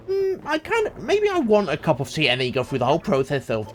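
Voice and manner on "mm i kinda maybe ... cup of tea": higher, hesitant and coy; unsure voice. Retold or imitated. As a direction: play the unsure tea drinker. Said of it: "if they go".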